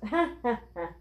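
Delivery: read-out ha-ha laugh; storybook character's hearty laugh; acted laugh. A narrator's reading. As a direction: laugh it out in separate ha's. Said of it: "threw back his head and laughed".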